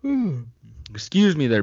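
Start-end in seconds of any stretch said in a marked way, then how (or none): 0.0-0.4 a long yawn with a high hum; big yawn. Real, not acted. Host caught yawning on air, a real big yawn. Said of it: "big yawn".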